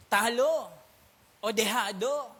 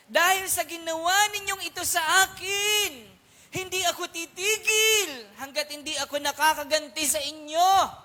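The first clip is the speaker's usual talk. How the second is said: loud, high vengeful vow; vengeful Samson; 6 semitones higher, 7 dB louder than usual. Acted. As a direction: declare it like Samson swearing revenge. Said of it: "anong sabi ni Samson". (malakas at mataas na panunumpa ng paghihiganti ni Samson)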